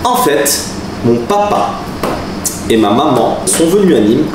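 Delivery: ordinary talk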